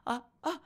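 sharp frightened gasps, high; startled gasps. Retold or imitated. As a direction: gasp in alarm twice.

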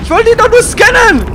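exasperated high-pitched protest; desperate whine. Real, not acted. Repeats the complaint more desperately.

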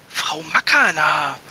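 slow, halting, nervous address; stammering. Acted. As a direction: stumble over her name, caught. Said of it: "stammelte er". (stockend und eingeschüchtert)

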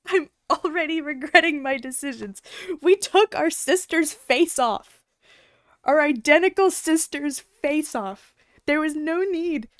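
loud exasperated rant. Real, not acted. Gamer ranting about the game's twist, loud exasperated rant. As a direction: vent loudly in disbelief.

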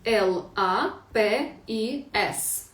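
«Л А П І С» повільно, кожна літера окремо; проговори літеру за літерою; повільна диктовка. Shown as a demonstration. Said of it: "що за слово вийшло". (вчителька ще раз повільно диктує слово)

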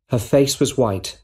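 plain reading voice

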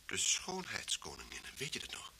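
soft unvoiced whisper; hint at it under your breath; whispered recollection. Detective whispers a reminder of the old case, hushed.

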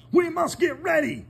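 the farmer bursting with news; excited Farmer Brown. Acted. Farmer Brown tells the cows about school, excited. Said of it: "he told the cows".

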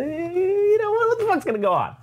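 high, fast, whining wail; whiny panicked soldier. Retold or imitated. Soldier warning the general about civilians, high panicked whine. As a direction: let it crack up into a frantic whine.

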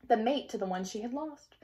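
usual speaking voice

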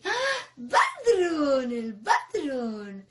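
grito alegre fingido, fuerte y estirado. Shown as a demonstration. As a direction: dilo feliz, con entusiasmo exagerado. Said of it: "alegres, happy, happy". (alegre, happy)

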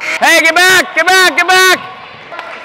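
high, loud, drawn-out get back; urgent yell. Real, not acted. Coach urgently yelling at players to get back, loud. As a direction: hurry them down the court with your voice.

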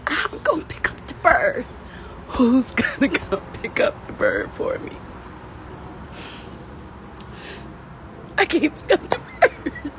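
high, tearful, breaking voice; crying, words pushed up high. Real, not acted. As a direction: plead helplessly through real tears. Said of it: "why is this making me cry".

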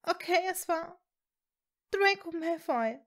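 ordinary delivery, nothing marked

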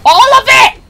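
high frustrated shout; raging gamer. Real, not acted. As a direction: yell it out in exasperation. Raging at section.

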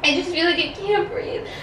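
staged panic attack for the prank, high breathless wailing; fake panic. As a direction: squeak out that you can't breathe. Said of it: "then I'll start the panic attack".

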